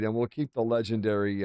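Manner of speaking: normal talking voice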